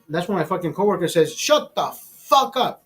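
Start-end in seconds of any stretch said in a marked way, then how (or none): 1.4-2.8 slow, high-pitched mock yell; retold yell. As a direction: act out a coworker's yell, each word stretched. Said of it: "That day he yelled at me".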